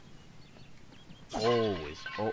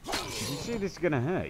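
higher mocking echo; mock repeat; 10 semitones higher, 5 dB louder than usual. Gamer mocking the game line, higher mock echo. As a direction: parrot the character. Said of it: "repeats mockingly".

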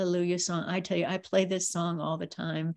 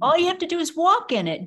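louder, higher and faster; emphatic repeat; 9 semitones higher, 6 dB louder, faster than usual. Shown as a demonstration. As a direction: drive the point home. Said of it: "Do you hear that?".

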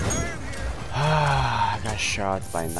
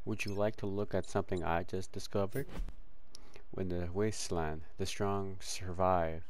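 hushed; 12 dB quieter than usual. Pretend. Hushed mock-dramatic quote.

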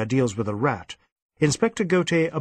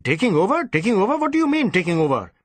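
indignant; 6 semitones higher, faster than usual. Acted. Superintendent outraged by his inspector's question, indignant. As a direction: scoff at the question.

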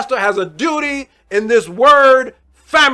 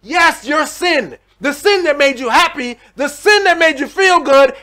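rising, louder sermon climax; preaching at full force; 5 semitones higher, 4 dB louder than usual. Acted. Preacher pressing sin on the congregation, rising to full force. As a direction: build the preaching up till it rings.

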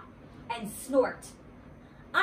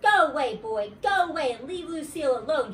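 loud, higher-pitched shooing shout; 6 semitones higher, 5 dB louder than usual. A narrator's reading. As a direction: shout to chase someone off. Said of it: "she shouted".

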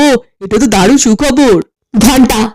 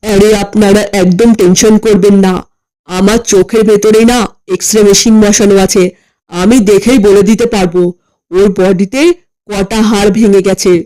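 low, slow, pompous quack voice; boastful doctor; 6 semitones lower than usual. Acted. Comic doctor reassuring the family about his x-ray eyes, low and pompous. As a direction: brag like a self-important quack.

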